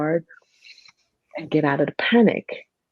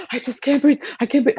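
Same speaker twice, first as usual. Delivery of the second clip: mock breathless panic, pitched up; patient struggling to breathe, pitched-up panicked gasps; gasping panic; 8 semitones higher, faster than usual. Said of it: "And they're gasping".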